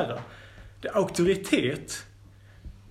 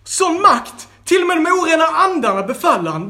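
high, loud, amazed exclaiming; frightened townsfolk asking each other what happened; astonished crowd; 11 semitones higher, 6 dB louder than usual. A narrator's reading. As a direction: gasp it out in disbelief. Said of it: "frågade varandra".

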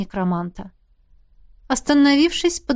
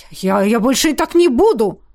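louder, stammering blurt; 2 semitones higher, 5 dB louder than usual. A narrator's reading. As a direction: blurt it out in distress. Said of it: "выпалил он".

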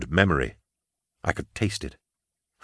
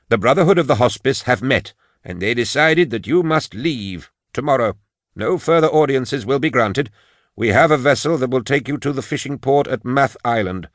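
higher-voiced officious brother laying down a ruling; stern official; 5 semitones higher than usual. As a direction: deliver the verdict in a higher character voice. Voices stern Jardone in dialogue.

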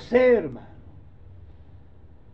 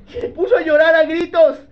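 higher, louder, stressing puso; loud emphatic; 8 semitones higher, 8 dB louder than usual. Acted. Preacher to the congregation, loud emphatic retelling. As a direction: hammer home that he wept aloud. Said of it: "repeat, louder".

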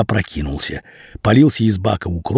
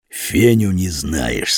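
slow, lower menacing thug; gang boss; 6 semitones lower, much slower than usual. A narrator's reading. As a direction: interrogate slowly like a crime boss.

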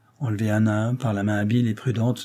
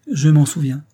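louder, trembling a little more; quivering reply, more moved; 5 semitones higher, 7 dB louder than usual. A narrator's reading. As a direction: let the voice shake more on the memory. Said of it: "sa voix tremblait un peu plus".

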